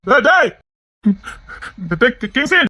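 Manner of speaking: high, loud yells of his name; shouting after him. Acted. Man yelling after fleeing Kingsley, loud stammering shout. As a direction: yell him back, stumbling on the name.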